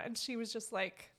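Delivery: ordinary talk, nothing marked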